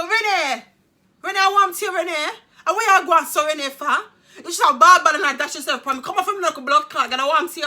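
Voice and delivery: high, loud Caribbean mum voice, scolding; imitating mum. Mum scolding daughter Renee in a loud, high Caribbean voice. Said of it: "And she go".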